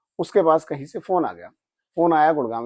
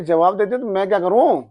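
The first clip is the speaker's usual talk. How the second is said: louder, higher irritated retort; angry retort; 6 semitones higher, 4 dB louder than usual. Retold or imitated. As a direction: throw the question back in anger. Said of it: "मुझे बहुत तेज गुस्सा आ गया". (गुस्से में ऊँची आवाज़ में जवाब)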